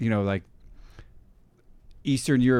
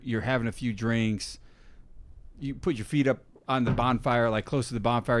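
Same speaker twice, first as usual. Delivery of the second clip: normal talking voice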